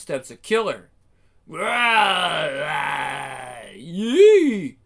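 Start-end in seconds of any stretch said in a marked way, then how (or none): 1.5-4.8 battle yell. Acted. Ash charging into the fight, loud whooping yell. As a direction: let out a wild war cry.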